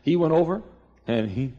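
usual speaking voice

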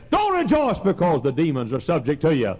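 high, loud preaching cry; fervent preaching. Acted. Preacher raising his voice to the congregation, high and loud. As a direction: lift it up like a revival preacher.

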